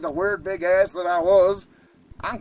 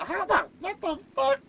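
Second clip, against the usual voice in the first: high-pitched, baffled outburst; baffled disbelief; 13 semitones higher than usual. Retold or imitated. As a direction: splutter it, voice jumping way up. Baffled as the dog escapes.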